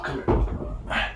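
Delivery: ordinary talk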